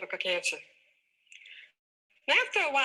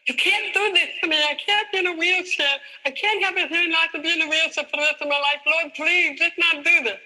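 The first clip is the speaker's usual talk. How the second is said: loud, high desperate yelling at the sky; crying out to God from the hospital wheelchair, loud and high; 6 semitones higher, 6 dB louder than usual. Retold or imitated. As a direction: shout your plea up to God, louder and higher. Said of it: "And I yelled at God".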